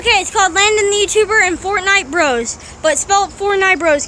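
loud excited announcing; calling out the channel name. Real, not acted. Loud shouting intro of channel.

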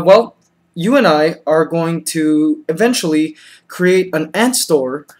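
ordinary talk, nothing marked

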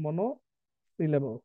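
"Monosyllable" very slow, split after মনো; broken into parts. Shown as a demonstration. Teacher giving class the new term, slow and split. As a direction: draw out "monosyllable" part by part, softly dropping away.

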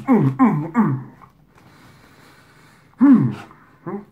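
humming while chewing food; muffled through closed chewing lips; mouth full, chewing. Real, not acted. Seen 0: Lips closed, jaw working while humming; fries eaten just before.

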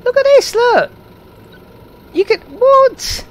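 very high, loud squeal; excited, high-pitched outburst; getting all excited. Real, not acted. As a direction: squeal in amazed delight. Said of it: "Sorry, I'm getting all excited now".

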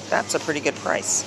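ordinary talk, nothing marked

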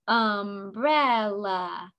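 "Umbrella" very slowly, three drawn-out beats: um-brel-la; split into syllables. Shown as a demonstration. Teacher repeating the head-tap exercise, "Umbrella" syllable by syllable. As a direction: stretch every syllable of "Umbrella" apart. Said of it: "Let's do it again".

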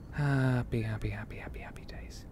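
fading to a soft, breathy whisper chant; whispered singsong. Pretend. Gamer chanting happy days to himself, whispered.

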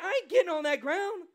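loud, high, cocky eagle voice; a hammed-up eagle bragging that it won't touch the ground; proud eagle. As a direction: strut it like a bird above it all. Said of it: "the eagle's like".

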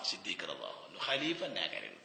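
ordinary delivery

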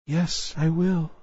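soft, high, slow and timid; small, tremulous consent; trembling voice. A narrator's reading. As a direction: answer faintly, trembling, as a frightened little princess. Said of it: "in a trembling voice".

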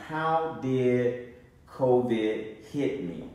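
normal talking voice

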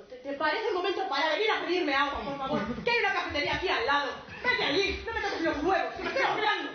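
loud, high, indignant scolding; flustered, hammed-up annoyance; comic exasperation. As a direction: send the intruder packing, half laughing. Would-be suicide scolds man asking for water.

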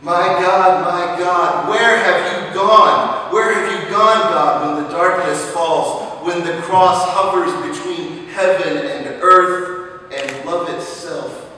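loud, high, rising sermon cry; preacher crying out to God over slain children, fiery; fiery preaching. Acted. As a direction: lift the congregation with each why. Preaching at full force.

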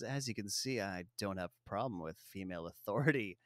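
low, soft, fast smarmy purr; a hint of a smooth-talking spy; suave Bond line. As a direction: lean in and deliver it like a smug seducer. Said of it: "he leans over her chair and he says".

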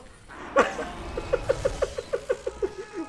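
airy high giggling; real breathless laughter. Real, not acted. Continued laughter.

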